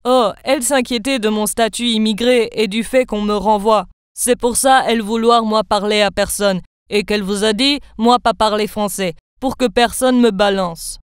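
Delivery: put-on a light foreign accent, halting broken French; broken immigrant French. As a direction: makeup artist explaining to the girl, lightly accented. Immigrant makeup artist explains secret.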